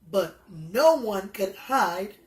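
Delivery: plain reading voice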